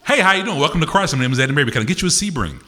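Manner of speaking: high, loud dealership welcome; car salesman greeting. Acted. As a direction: pitch a car like an eager salesman. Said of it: "that's what it sounds like".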